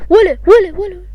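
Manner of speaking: loud high yell; shouting at someone. Real, not acted. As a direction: call him out sharply. Yelling at someone. (صرخة عالية حادة)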